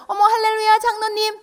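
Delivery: high, loud syrupy church-lady greeting; fake sweet greeting. Mother fawning over the elder she just badmouthed, high and sugary.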